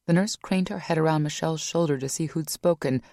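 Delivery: ordinary delivery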